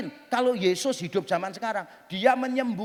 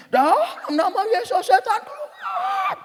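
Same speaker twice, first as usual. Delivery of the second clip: louder mock exorcist yell, drawn out and very high; intensified exorcism command; 11 semitones higher, 5 dB louder, slower than usual. Hamba Tuhan raising intonation and volume at a demon, mock yell. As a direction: stretch it into a soaring, hammed-up squeal. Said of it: "Dia tingkatkan lagi intonasi dan volumenya".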